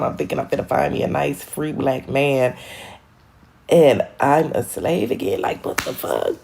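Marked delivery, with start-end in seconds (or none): none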